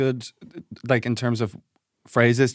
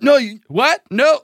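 much higher, louder sputtering; flustered disbelief; 11 semitones higher, 6 dB louder, slower than usual. Retold or imitated. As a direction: splutter like you can't believe it. Protester stunned at arrest.

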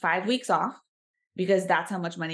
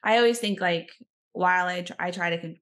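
usual speaking voice

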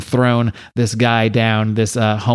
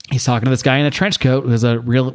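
ordinary talk, nothing marked

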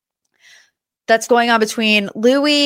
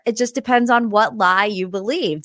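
normal talking voice

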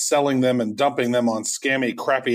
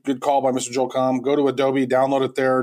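ordinary talk, nothing marked

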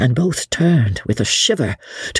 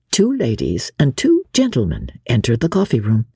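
usual speaking voice

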